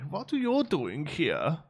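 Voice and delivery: put-on slow, drawn-out question in a hammy German accent; Maximilian's German accent. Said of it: "really sort of over-the-top German accent".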